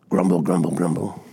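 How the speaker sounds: low, muttered grumble, grumble, grumble; mock grumbling crowd. Mock grumbling Israelites in response.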